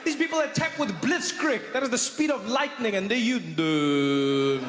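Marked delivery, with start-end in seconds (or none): 3.4-4.6 slow, low dithering with stretched fillers; stammering French. Acted. French facing the efficient Germans, hesitant drawl. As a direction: stall endlessly like a mock hesitant French speaker. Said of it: "and you stuttering and stammering?".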